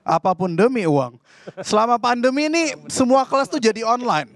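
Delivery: ordinary delivery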